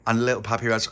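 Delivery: plain reading voice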